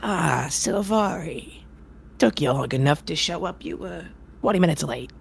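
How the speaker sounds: a gruff, impatient circus ringmaster scolding. Acted. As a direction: grumble at the late performer. GM plays ringmaster.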